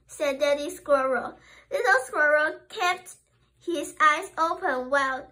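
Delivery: ordinary delivery, nothing marked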